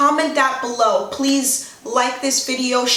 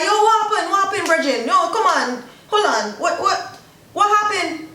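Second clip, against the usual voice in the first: alarmed friend; 7 semitones higher than usual. Retold or imitated. A worried friend pressing Bridget, alarmed and urgent. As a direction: press Bridget for what happened.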